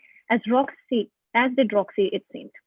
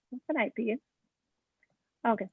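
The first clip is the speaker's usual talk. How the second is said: plain reading voice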